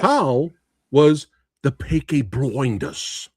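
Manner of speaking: slow, hammed-up fake English accent; mock English with exaggerated vowels; bad English accent. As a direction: draw it out in a terrible English accent. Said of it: "that accent wounded me".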